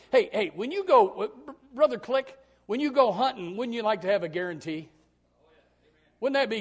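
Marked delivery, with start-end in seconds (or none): none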